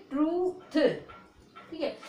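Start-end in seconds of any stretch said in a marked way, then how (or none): 0.1-0.8 "ट्रूथ" pitched up, long oo; sounded out. Shown as a demonstration. Teacher repeats the drill for learners, sounded out "ट्रूथ". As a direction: draw out "ट्रू", then a separate th. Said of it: "क्या बोलोगे".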